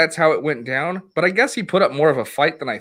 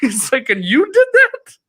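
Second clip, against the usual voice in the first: high, loud, half-laughing disbelief; awed disbelief; 11 semitones higher, 4 dB louder than usual. Retold or imitated. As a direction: imitate him gasping in amazement. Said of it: "he was like".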